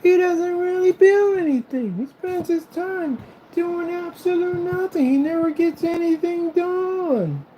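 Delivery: very high, loud, drawn-out whiny imitation; mocking critic. Imagined viewer complaining about his talk, mocking squeaky voice. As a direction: mimic the doubter in a squeaky, stretched-out voice. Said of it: "You were like".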